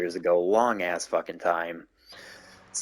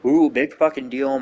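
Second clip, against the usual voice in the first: sarcastic; 5 semitones higher, 6 dB louder than usual. Real, not acted. Mocking uncle's complaint.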